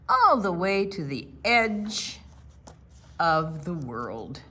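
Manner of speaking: slow, drawn-out wise owl giving directions; unhurried, mysterious directions; slow storytelling owl. Acted. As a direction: lay out the journey slowly like a wise old owl. Barn Owl gives directions.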